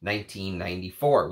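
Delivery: normal talking voice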